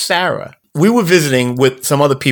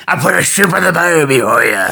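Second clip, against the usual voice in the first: hammed-up pirate voice; 2 semitones higher than usual. Host showing what a pirate is, pirate voice. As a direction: growl it like a cartoon pirate. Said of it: "a pirate is".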